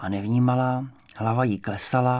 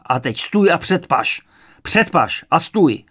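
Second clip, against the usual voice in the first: loud, higher drill commands; shouted orders; 5 semitones higher, 7 dB louder than usual. A narrator's reading. Interrogator making the frightened mother stand, shouted orders. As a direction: order her about at full voice.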